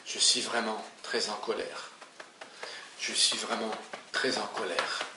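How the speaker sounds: répétée doucement, voix basse, sans forcer; phrase de rappel, à voix basse. Shown as a demonstration. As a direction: murmurez la phrase comme un refrain en tapotant. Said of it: "en restant dans l'émotion de la colère". (répétition douce et basse, comme un mantra)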